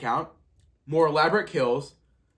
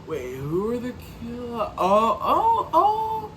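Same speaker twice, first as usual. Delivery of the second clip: puzzled realization; 6 semitones higher, slower than usual. Retold or imitated. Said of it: "It's like".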